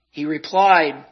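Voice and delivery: usual speaking voice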